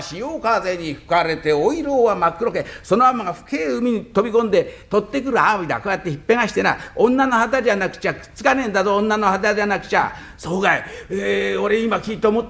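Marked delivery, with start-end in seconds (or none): none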